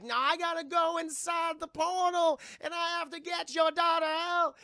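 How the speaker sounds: high, loud put-on medium voice; insistent medium. Medium argues with mother.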